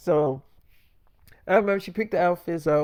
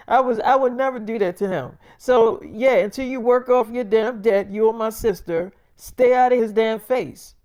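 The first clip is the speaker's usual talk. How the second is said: raised, defiant talking back; a daughter snapping back at her thieving mother; angry retort; 5 semitones higher, 5 dB louder than usual. Retold or imitated. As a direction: push back loud and hard at her own mother. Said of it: "she like".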